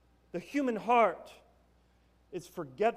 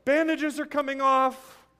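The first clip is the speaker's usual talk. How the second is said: higher and louder, righteous outrage; mock indignant patient; 6 semitones higher, 7 dB louder than usual. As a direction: pull the sheet down and prove the point, hammed up. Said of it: "He's like".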